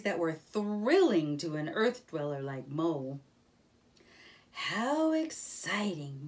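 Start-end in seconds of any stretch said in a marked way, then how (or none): 4.5-6.3 slow, wondering delight; mild excitement. A narrator's reading. Mole reacting to Rat's thrilling river stories with delight. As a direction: savour it with a touch of awe. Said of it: "Mole cried".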